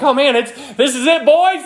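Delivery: high, thrilled exclamation, breaking into a laugh; excited Peter. Acted. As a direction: act out an overexcited disciple cheering. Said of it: "he gets so excited".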